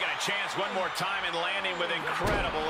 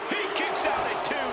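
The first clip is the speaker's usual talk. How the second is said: excited commentary; 7 semitones higher than usual. Acted. Near fall called.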